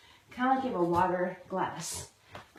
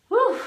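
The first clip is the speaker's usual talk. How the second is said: loud relieved whew; 12 semitones higher, 10 dB louder than usual. Real, not acted. End of final set.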